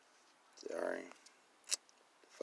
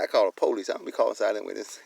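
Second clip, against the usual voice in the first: normal talking voice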